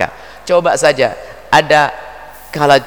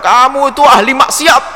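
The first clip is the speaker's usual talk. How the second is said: higher, louder, jabbing finger-point yell; accusing shout; 10 semitones higher, 5 dB louder than usual. Retold or imitated. A scornful accuser yells at the preacher's listener, loud accusing shout. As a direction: hurl the accusation like a public charge.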